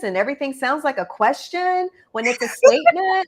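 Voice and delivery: rising at the end like a question; imitating uptalk; uptalk demo. Shown as a demonstration. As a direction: end the statement on a questioning rise. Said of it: "goes up at the end of all their sentences".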